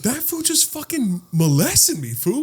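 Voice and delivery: high-pitched indignation without shouting; retold indignant protest. Drunk man reporting to his friends, high indignant protest.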